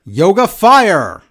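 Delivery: loud, slow, high battle cry; game fighter's catchphrase. Acted. Correcting co-host on which fighter makes which noise, loud cry. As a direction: draw out "fire" like a special move. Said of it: "He doesn't make that noise".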